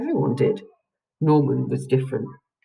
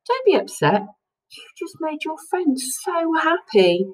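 gentle dad; 13 semitones higher than usual. A narrator's reading. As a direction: soothe a disappointed child. Dad comforting Lucy after race.

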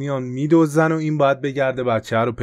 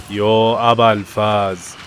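slow, louder drawn-out cry of fear; alarmed cry; 7 dB louder, much slower than usual. Real, not acted. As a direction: drag it out as the plane goes down. Plane crashing.

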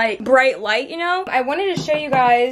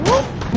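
startled whoop; 14 semitones higher than usual. Real, not acted. Nearly dropping the egg rack.

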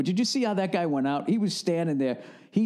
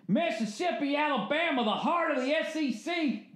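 very high, hammed-up holler; over-the-top regional pride, pitched high; mock Southern booster; 12 semitones higher, 3 dB quieter than usual. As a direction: whoop the names in a strained high voice. Mock holler about southern football country.

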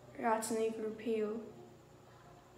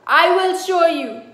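threatening husband; 7 semitones higher, 17 dB louder than usual. Acted. Husband threatening before beating.

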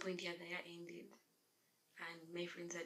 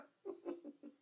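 light trailing chuckle. Real, not acted. Vlogger amused at asking viewers her age, chuckling. Laughing at own age joke.

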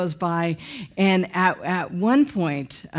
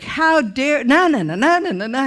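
raised, higher-pitched indignant sputter; mock angry outburst; 6 semitones higher, 4 dB louder than usual. Husband scolding the pastor for doubting his wife, mock angry. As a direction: imitate an outraged husband mid-rant. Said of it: "my husband was so mad".

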